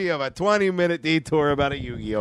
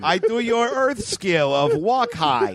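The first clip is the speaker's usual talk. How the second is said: high, slow, stilted alien; 4 semitones higher, slower than usual. Acted. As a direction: speak like an alien faking human. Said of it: "things an alien says".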